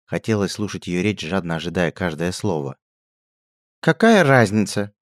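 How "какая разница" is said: high, slow, loud contempt; scornful snort. A narrator's reading. Princess sneering at the duchess, high slow contempt. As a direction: sneer it dismissively. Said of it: "презрительно фыркнула принцесса".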